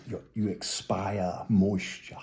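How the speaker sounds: ordinary delivery, nothing marked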